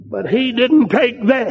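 ordinary talk

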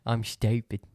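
normal talking voice

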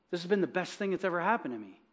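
ordinary talk

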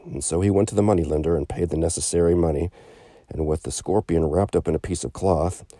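usual speaking voice

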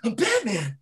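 a hint of a laid-back Boston guy; Mark Wahlberg Batman. Wahlberg introducing himself as Batman, lightly voiced. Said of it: "Mark Wahlberg's gonna be Batman".

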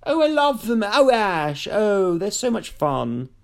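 high, drawn-out gush; gushing impression. Impersonating a Charlotte type who likes everything, high gushing. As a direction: coo it in an over-keen put-on voice. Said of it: "she would say it's".

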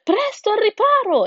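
high, loud warning cry; alarmed turkey. A narrator's reading. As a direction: shout for everyone to take cover. Turkey shouts for cover. (tacchino che grida allarmato)

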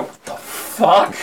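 drawn-out, hushed mock disbelief; stunned retold reaction. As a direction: stretch it out slowly, voice falling away. Retold baffled reaction to the click.

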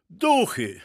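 high, startled cry; short shriek. A narrator's reading. Guinea pig alarmed at hearing of ghosts, short high shriek. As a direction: yelp it in brief alarm. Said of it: "i krótko wrzasnął".

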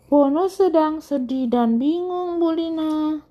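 high put-on character voice; high-pitched storybook pupil; story child's voice. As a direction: answer the teacher in a high singsong. Story child answers teacher, voiced.

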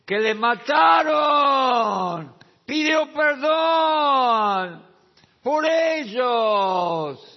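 slow, high, sung-out preacher's shout; preaching shout. Acted. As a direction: belt it out like the sermon's peak. Climax on Christ forgiving killers.

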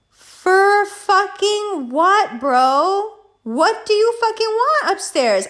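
high, drawn-out, incredulous outburst; exasperated retort. Retold or imitated. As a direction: stretch each word in mock outrage. Retold angry reply, mean to him.

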